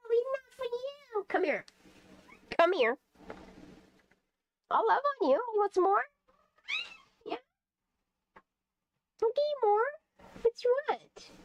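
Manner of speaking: very high sweet voice. Real, not acted. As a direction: offer the treats in a tiny high voice. Coaxing cat with treats.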